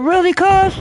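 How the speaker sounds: high incredulous really; stung surprise at his cousin; indignant disbelief. Retold or imitated. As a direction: act shocked at family turning on you.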